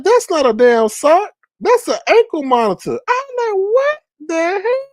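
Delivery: scandalised storyteller's amazement; comic disbelief. Retold or imitated. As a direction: act out a shocked discovery, pitch climbing. Retold discovery of ankle monitor.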